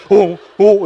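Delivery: loud frightened whimper; scared child. Retold or imitated. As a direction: cower like a startled toddler. 겁먹은 아이 흉내. (겁먹은 아이처럼 크게 떨며)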